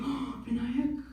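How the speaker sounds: high put-on auntie voice; mom's impressed friends. Mom's friends to comedian who met Russell Peters, impressed and envious.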